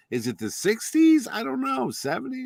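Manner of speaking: confused, pitch climbing; puzzled musing. Retold or imitated. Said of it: "I'm like".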